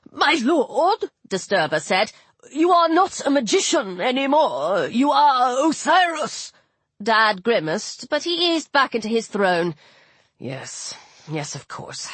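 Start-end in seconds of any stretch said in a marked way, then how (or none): 0.1-1.1 high, protesting address; slightly scandalised god; remonstrating. A narrator's reading. God stops his lord rising. 2.5-6.5 high, emphatic protest; fussy god's raised-voice reminder; remonstrating. A narrator's reading. God reminds lord of his station. 10.4-12.1 low, very soft concession; subdued, murmured agreement; resigned. A narrator's reading. Father gives in, sits back down.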